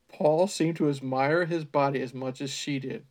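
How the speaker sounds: ordinary talk, nothing marked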